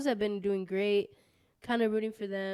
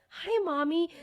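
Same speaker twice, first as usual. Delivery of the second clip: put-on higher, childlike little voice; creepy dog voice; 8 semitones higher, slower than usual. Friend imagines the talking dog greeting mommy, creepy childlike. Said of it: "It would be so creepy if he was like".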